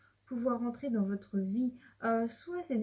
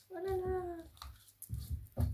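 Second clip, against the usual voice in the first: high, very slow, soft oh là là; awed sigh; 9 semitones higher, 5 dB quieter, much slower than usual. Real, not acted. Reader marvelling at a beautiful card, slow and high. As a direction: marvel at it slowly. Admiring the cards.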